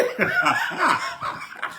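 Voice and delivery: a sudden high, breathy burst of laughter. Real, not acted. (éclat de rire aigu et soufflé)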